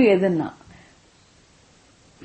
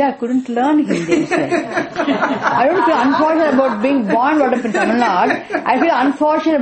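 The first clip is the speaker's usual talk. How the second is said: laughing while talking; 5 semitones higher, 5 dB louder than usual. Real, not acted. Laughing reply to listener's tease.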